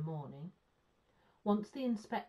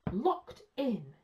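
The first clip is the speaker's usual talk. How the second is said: loud, raised-pitch indignation; bitter exclamation; 4 semitones higher, 6 dB louder than usual. A narrator's reading. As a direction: exclaim it with wounded outrage. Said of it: "he exclaimed bitterly".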